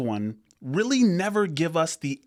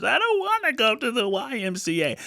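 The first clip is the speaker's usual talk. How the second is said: put-on high, whining child voice, much higher than his own; whiny little daughter; 10 semitones higher than usual. Dad imitating his daughter refusing the gym, high whine. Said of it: "She decides to just fall apart".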